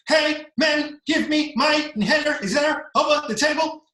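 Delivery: high, loud, strained mock-rap voice; high-pitched wheezy parody of a rapper; asthmatic rapper. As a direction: imitate a rapper singing through an asthma attack. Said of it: "he's having, like, an asthma attack".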